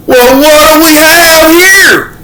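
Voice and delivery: high, slow Southern cop voice. Retold or imitated. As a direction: stretch it out like a swaggering country cop. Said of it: "country redneck".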